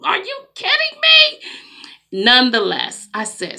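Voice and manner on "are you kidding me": much higher, fast, rising outrage; scandalized, climbing to a squeal; retold disbelief at the sister in the dollar store; disbelieving. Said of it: "I'm like".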